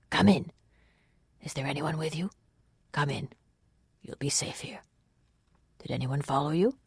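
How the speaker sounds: hushed, unhurried questions; softly reassure, with no urgency at all; mild, peaceable. A narrator's reading. Waylord sheltering a fugitive at night, mild and peaceable. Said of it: "He spoke in a mild, peaceable tone".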